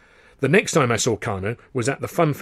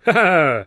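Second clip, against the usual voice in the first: loud, higher jolly laugh; hearty laugh; 7 semitones higher, 8 dB louder than usual. A narrator's reading. Carno laughs at his rhyme.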